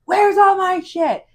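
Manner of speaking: high, loud, slow frantic yell; panic-yell at the cooks during rush hour; mock freaking out. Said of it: "we're freaking out".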